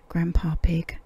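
ordinary delivery, nothing marked

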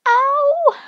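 high loud yelp of pain; sharp cry of pain. Real, not acted. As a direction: cry out when it stings. Taking lashes off hurts.

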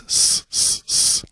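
sharp, hissy s, s, s; sustained sharp s hisses; hissed S sounds. Shown as a demonstration. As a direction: hiss out a sharp sibilant s. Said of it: "if I, uh, pronounce an S".

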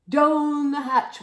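big slow shouted cheer; crew shout. Acted. Crew answering their captain, loud shout. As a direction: shout it all together. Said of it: "we all shouted".

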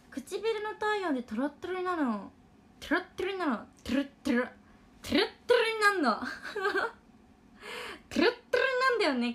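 high, loud, thrilled; excited gushing. Real, not acted. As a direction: gush over it with delight. Gushing over melting lipstick.

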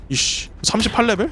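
ordinary talk, nothing marked

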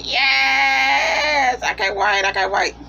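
giddy fangirl shout of joy; thrilled yes. Retold or imitated. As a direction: cheer for her favourite character. Thrilled at Madam Xanadu's arrival.

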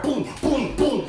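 high loud booms; punch noises. Retold or imitated. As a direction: hammer each boom. Acting out punches.

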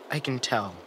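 ordinary delivery

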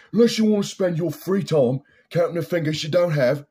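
loud, high-pitched menacing Cockney tough guy; Lenny McLean. Acted. Threatening two Northern English guys in Snatch, menacing Cockney impression. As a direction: threaten them in an over-the-top English gangster voice. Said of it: "when I was doing my, like, English impressions".

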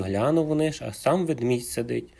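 ordinary delivery

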